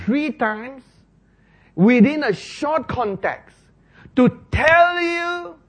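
loud, high, slow pulpit emphasis, each word hammered; preaching at full force. Acted. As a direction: thunder it out to the congregation.

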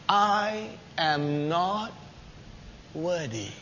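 slow, soft and humble; humble Peter. Acted. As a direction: shrink down as you say it.

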